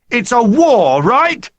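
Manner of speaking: high, loud rallying call. A narrator's reading. Hunter rallying the boys against the oiks, loud call. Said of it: "raised his voice".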